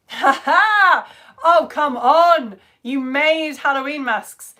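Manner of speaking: loud, high, drawn-out excitement; thrilled, loud and slow; real delight. Real, not acted. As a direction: exclaim with delight, stretching the words. Thrilled by photo of masks.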